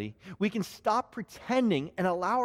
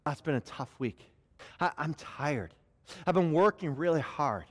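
honest weary prayer; 4 semitones lower than usual. Retold or imitated. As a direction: let the guard down and admit fatigue. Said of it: "we can be very vulnerable".

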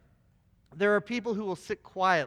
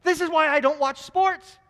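higher and louder, fed-up disbelief; exasperated wife; 7 semitones higher, 6 dB louder than usual. Retold or imitated. Wife scoffing at a basketball commentator, exasperated and loud. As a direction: throw up your hands at the commentator. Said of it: "she says".

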